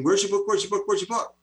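the keen audience pressing him for a book; eager demand. Retold or imitated. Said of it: "people began saying".

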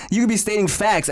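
ordinary delivery

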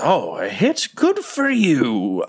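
slow, swooping monster taunt; hammed-up villainous mock praise; mocking beholder. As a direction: jeer in a drawn-out creature voice, rising high. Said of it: "kinda laughs and says".

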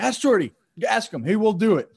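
high, loud imitation of an insistent coach; insistent exclaiming. Bench coach sends players to ask Shorty, insistent exclaiming.

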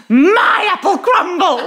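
high, loud laughing squawk. Retold or imitated. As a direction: shriek it like a startled bird. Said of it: "I literally squawked".

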